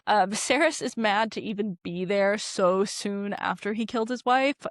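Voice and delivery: usual speaking voice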